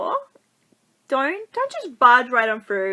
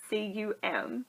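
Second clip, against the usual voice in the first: normal talking voice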